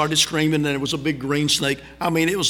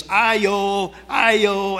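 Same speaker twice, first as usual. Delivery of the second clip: higher sing-song call of Ayo from outside a door; 4 semitones higher than usual. Retold or imitated. Preacher showing how Filipinos call at a house, calling out. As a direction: call out Ayo as if at someone's gate. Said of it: "in the Philippines, it's".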